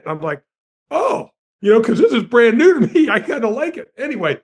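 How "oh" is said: high-pitched, startled little "oh"; mock a naive newcomer's surprise, pitched way up; taken-aback oh. Newcomer hears the star hates Los Angeles, taken aback.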